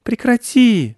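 high, loud ticklish squeal; boy's high-pitched giggly cry; giggly protest. A narrator's reading. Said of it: "захихикал он".

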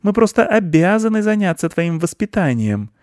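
high, righteous aunt voice; dutiful scolding aunt; aunt mouse. A narrator's reading. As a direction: insist primly in a high voice. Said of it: "не согласилась его тётя".

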